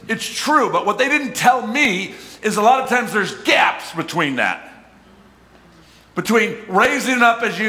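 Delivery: loud, high, driving preacher's voice; preaching at full force. Acted. Pastor preaching hard to church about gaps. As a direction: preach it out to the congregation.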